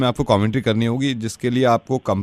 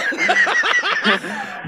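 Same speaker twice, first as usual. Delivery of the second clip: laughing. Real, not acted. Prankster breaks into laughter.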